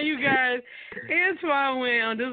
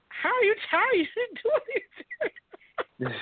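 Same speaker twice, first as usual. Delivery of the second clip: higher-pitched, incredulous teasing retort; mock disbelief; 10 semitones higher, faster than usual. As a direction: act out baffled disbelief, amused. Retelling own disbelieving retort to driver.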